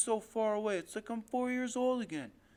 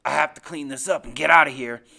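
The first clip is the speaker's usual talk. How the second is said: low, loud, forceful growing threat; menacing resolve; 6 semitones lower, 15 dB louder than usual. Acted. Father resolves to get rid of witnesses, loud low menace. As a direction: say it hard, as a decision.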